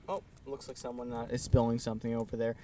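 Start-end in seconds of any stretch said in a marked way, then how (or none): none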